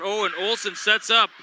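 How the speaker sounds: rising shout on the play; real play-by-play burst; excited call. Real, not acted.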